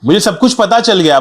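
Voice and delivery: usual speaking voice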